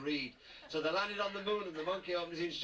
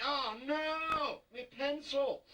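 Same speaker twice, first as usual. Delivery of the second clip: mock dismay; 12 semitones higher, 3 dB louder, much slower than usual. Sawmill worker loses his ear.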